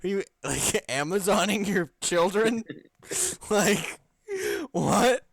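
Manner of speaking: can't believe it, laughing; laughing disbelief. Real, not acted.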